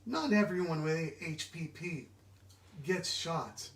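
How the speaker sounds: usual speaking voice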